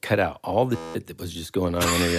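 ordinary delivery

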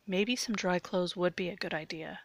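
ordinary delivery, nothing marked

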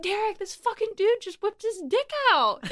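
high, outraged report to a friend; alarmed retelling. Retold or imitated. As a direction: reenact urgently telling on someone.

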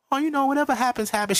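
mock woman shrugging off a guy's question; imitating a woman. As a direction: play it light and noncommittal, like a woman dodging the question. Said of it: "if you say to her".

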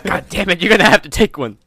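gruff chief. Acted. Acting out cop-movie partner quarrel.

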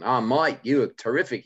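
lively imitation of an Australian; Australian accent with swooping pitch. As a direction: gush in a put-on Australian accent. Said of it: "this guy from Australia".

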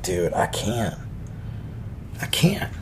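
too tired to deal, fading out; weary giving up. Retold or imitated. Exhausted reply to drunk guy.

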